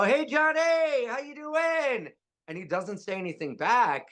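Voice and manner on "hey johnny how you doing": high, slow, sing-song call; calling out hello. Retold or imitated. Riding past his friend, calling out a high slow greeting. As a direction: sing out a greeting across the street. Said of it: "and I go".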